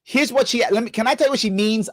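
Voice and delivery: ordinary delivery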